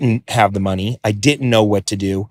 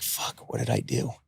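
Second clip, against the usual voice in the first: much softer, under the breath; muttered dread; 16 dB quieter than usual. Retold or imitated. Realizing at the auction he made a mistake, muttered dread. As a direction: say it to yourself in dread.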